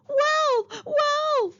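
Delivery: high, loud pretend scream of Wolf; screaming for help that isn't needed; fake scream. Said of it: "she screamed at the top of her voice".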